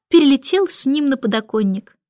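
ordinary delivery, nothing marked

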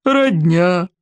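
incredulous chief repeating the word; lightly scoffing aside; amused disbelief. A narrator's reading. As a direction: muse on "Родня", high then dropping. Chief shakes head, still amused.

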